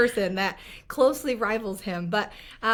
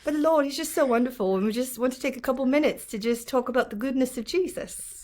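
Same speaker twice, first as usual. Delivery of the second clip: put-on British accent, slightly raised; 3 semitones higher than usual. Shown as a demonstration. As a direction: play a churchy host with a British accent. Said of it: "talk like a British accent".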